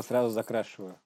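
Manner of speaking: normal talking voice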